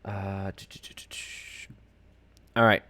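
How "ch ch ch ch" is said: soft whispered "ch-ch-ch"; absent-minded string of soft "ch" sounds; idle clicking noises. Real, not acted. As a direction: fill time with quiet unvoiced mouth noises. Filling time while shifting things on screen.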